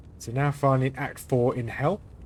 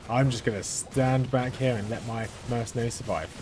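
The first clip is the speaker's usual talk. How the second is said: ordinary talk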